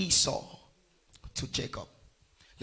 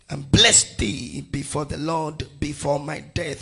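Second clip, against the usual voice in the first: emphatic, measured Bible reading; scripture reading; 5 dB louder than usual. Acted. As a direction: proclaim the verse phrase by phrase. Reads scripture aloud to congregation.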